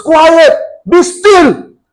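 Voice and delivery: firm, loud command to the waves; commanding shout. A narrator's reading. Jesus rebuking the wind and waves, loud command. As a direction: order the storm to stop. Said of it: "said to the waves".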